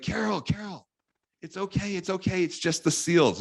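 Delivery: hushed, gentle calming of a frightened friend; soothing neighbor. Retold or imitated.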